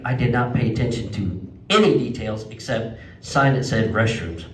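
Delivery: ordinary talk, nothing marked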